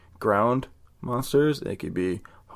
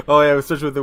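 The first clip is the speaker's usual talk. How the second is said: ordinary talk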